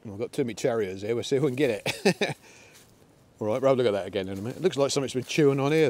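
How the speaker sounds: ordinary talk, nothing marked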